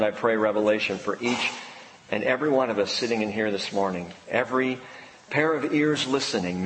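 hushed pulpit prayer. Acted. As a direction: pray over the congregation gently. Pastor's closing prayer.

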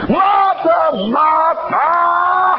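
high, drawn-out exasperated cry of both names; emphatic shouted rebuke. Shown as a demonstration. Preacher showing reader how Jesus might say it, emphatic shout. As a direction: belt out MARTHA, MARTHA, stretched and high. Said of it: "Put some Jesus in there".

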